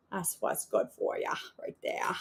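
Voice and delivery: put-on whispered Maine accent. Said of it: "talking like a Mainer".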